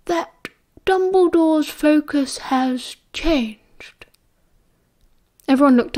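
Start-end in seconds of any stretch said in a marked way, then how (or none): 0.1-4.1 put-on slow, calm, higher elderly wizard voice; unhurried and grave; calm old wizard. A narrator's reading. Dumbledore calmly addresses the gathered Order, slow and grave.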